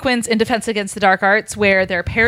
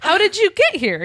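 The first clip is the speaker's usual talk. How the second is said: mock startled Tom; 11 semitones higher than usual. Tom voiced high.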